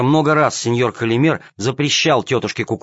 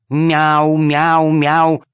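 repeated meows; 4 semitones higher, 4 dB louder, much slower than usual. A narrator's reading. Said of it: "повторила кошка-хромоножка".

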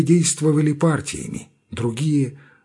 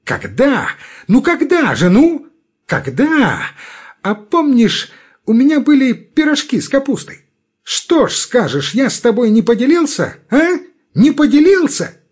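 much higher, cocky challenging; feisty; 11 semitones higher, 5 dB louder than usual. A narrator's reading. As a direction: needle him eagerly. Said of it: "с задором переспрашивает Федченко".

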